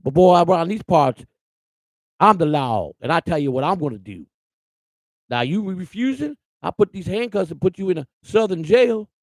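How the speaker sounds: imitating a sneering Southern deputy; Southern sheriff. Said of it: "That's how they talk to you".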